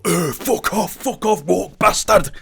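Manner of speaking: high, loud snarling rant; grumpy critic persona. Pretend. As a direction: shoo a fan away like a cranky critic. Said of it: "If you come up to me in public, I'll be like".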